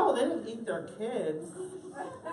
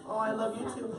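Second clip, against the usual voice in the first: ordinary talk, nothing marked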